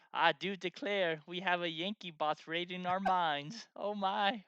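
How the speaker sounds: high, loud Southern belle voice; theatrical Southern belle reading aloud. Acted. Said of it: "your beautiful, uh, Southern Belle voice".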